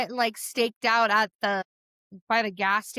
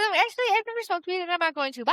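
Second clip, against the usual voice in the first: mock prim girl; 7 semitones higher, faster than usual. Mock exit line.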